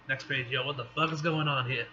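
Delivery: plain reading voice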